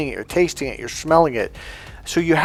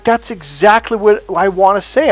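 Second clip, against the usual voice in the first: eager women, imitated; 6 semitones higher, 4 dB louder than usual. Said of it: "'Cause they're like".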